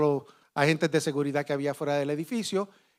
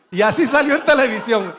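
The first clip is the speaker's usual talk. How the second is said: laughing; 4 semitones higher, 6 dB louder than usual. Real, not acted. Repeating the punchline, laughing.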